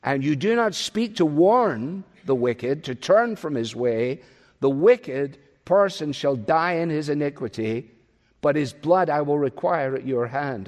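normal talking voice

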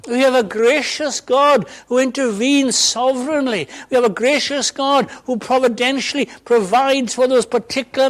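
high, loud impassioned preaching; fervent preaching. Acted. Preacher assuring the congregation of God's help, fervent and loud. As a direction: build it up to a triumphant cry.

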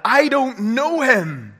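loud, high, drawn-out outburst; angry denial. Acted. Peter losing his cool over Jesus, angry shout. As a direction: shout it slowly, hammering out the denial. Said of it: "angry words of Peter's".

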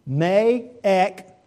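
ek spoken sharp and loud; Me, ek, each word set apart and punched; Greek ek. Shown as a demonstration. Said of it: "It's in the Greek".

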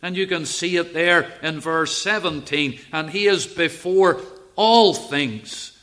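loud, lifted preaching voice; preaching at full force. Acted. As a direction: proclaim it to the congregation.

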